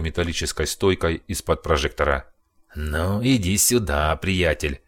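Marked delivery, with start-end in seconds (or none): 2.7-4.8 menacing taunt. Acted. Brawler Alex taunts.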